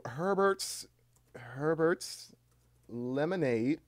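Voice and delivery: slow words with rising ends and long gaps; drawn-out dictation. Real, not acted. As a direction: stretch each word as if typing it. Spelling out new title while changing it.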